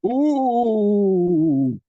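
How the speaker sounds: loud crowd ooh. Retold or imitated. Comedian mimicking the shocked crowd, loud drawn-out ooh. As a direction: ham up the scandalised crowd. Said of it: "They were like".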